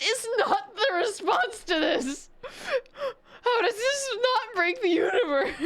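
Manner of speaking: high-pitched disbelief breaking into laughter; real baffled outburst, voice pitched high; incredulous, near laughter. Real, not acted. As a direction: protest while cracking up. Baffled, cracking up at orc lore.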